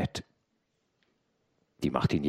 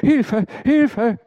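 very high, loud scream; frantic cry of alarm; screaming for help; 11 dB louder than usual. A narrator's reading. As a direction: scream for rescue at full pitch. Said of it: "schrie die Martinière".

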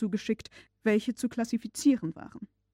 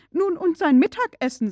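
higher, louder fretting housekeeper; old servant's worry; 8 semitones higher, 8 dB louder than usual. A narrator's reading. Martha worrying about the master's lunch, fretting old servant. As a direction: fuss like an anxious old servant. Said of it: "sagte die alte Dienerin".

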